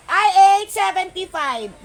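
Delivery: loud announcement of the code "I eight"; announcing loudly. Real, not acted. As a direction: belt out the item code.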